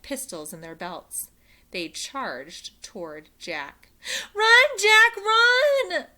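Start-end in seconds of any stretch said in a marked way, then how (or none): 4.3-6.1 high, loud desperate cry. A narrator's reading. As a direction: scream it in panic. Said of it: "cried Annie".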